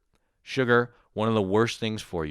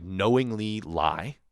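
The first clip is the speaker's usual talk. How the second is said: ordinary talk, nothing marked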